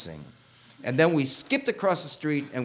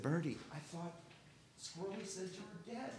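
much softer, higher, tender murmur; hushed; 5 semitones higher, 18 dB quieter than usual. Retold or imitated. Hugging the friend he thought dead, soft and tender. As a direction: say it softly into her shoulder.

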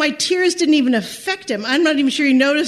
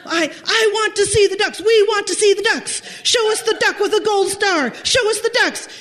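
much higher retold yell demanding the ducks; furious customer yelling to see the ducks, pitched way up; angry shouting; 8 semitones higher than usual. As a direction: shout it at the booth girl like an irate customer. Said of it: "gets more and more worked up".

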